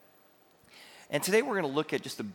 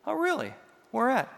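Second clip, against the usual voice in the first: playing dumb with mom, voice shooting up; mock surprise; 12 semitones higher, 4 dB louder than usual. Son on the phone teasing mom, in mock high surprise. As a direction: pitch jumps high on a playful feigned "Oh, really?".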